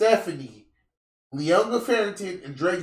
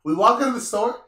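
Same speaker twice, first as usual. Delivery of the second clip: ordinary delivery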